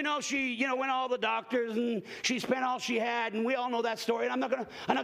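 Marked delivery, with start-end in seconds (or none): none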